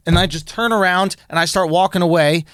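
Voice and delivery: normal talking voice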